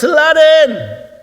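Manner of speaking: high, long-drawn shout for a hero; desperate shout. Acted. Kid shouts to his hero on the passing float, desperate shout. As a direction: yell it out, stretching the name. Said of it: "And so he shouts".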